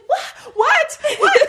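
very high, loud, urgent what; startled demand while driving; alarmed. Retold or imitated. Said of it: "So I'm like".

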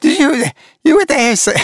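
high giggling laugh breaking through the words; can't stop laughing at the clip; laughing helplessly. Real, not acted. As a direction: giggles spilling into the question. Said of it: "I shouldn't be laughing at that".

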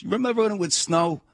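put-on high, broad New York fireman accent; a touch of tough New York cop; retold New York fireman, light accent. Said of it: "in this heavy New York accent".

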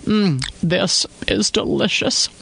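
talking through a mouthful; mouth full. Acted. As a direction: savour it with food in mouth. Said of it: "she said with her mouth full".

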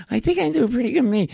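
high, quick, squeaky-pitched celebrity impression; Jay Leno. Comic quoting the host defending himself, high impression. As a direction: put on the talk-show host's high-pitched voice. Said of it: "turned out to be Jay Leno".

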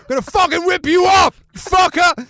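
high, loud yell of an enraged mob; mock angry shout. Retelling the stadium crowd's threats, mock enraged shout. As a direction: imitate a furious heckler shouting threats.

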